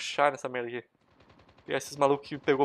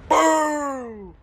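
high, loud, long "pum"; cartoonish high boom; imitated gunshot boom; 11 semitones higher, 6 dB louder than usual. Real, not acted. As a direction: sing out the bang. Imitates shot while firing.

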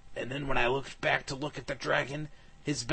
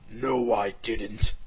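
slow, lightly put-on dragon voice; lying dragon; 3 semitones lower, much slower than usual. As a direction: drag it out, fibbing. Dragon lies about bones.

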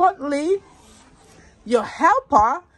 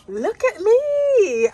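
high, slow look-at-me brag; showing off; 10 semitones higher, much slower than usual. Acted. Vlogger to her viewers, flaunting her outfit slowly and high. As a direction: stretch it out, pleased with yourself.

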